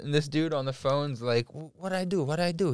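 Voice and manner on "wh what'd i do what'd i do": higher, softer imitated Italian protest; mild Italian accent. Storyteller voicing Italian man on the phone, light accent. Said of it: "he had an accent".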